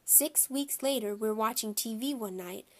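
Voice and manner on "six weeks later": ordinary delivery, nothing marked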